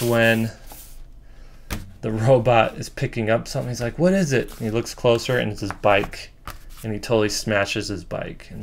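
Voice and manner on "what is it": higher-pitched curious question; lightly acted puzzlement, pitched up; puzzled. Robot picking up something unknown, puzzled.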